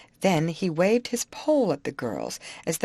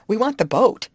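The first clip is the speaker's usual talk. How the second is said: raised, louder exclamation; higher, louder insistence; insistent cry; 5 semitones higher, 8 dB louder than usual. Acted. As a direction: cry out what you want. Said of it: "cried Molly".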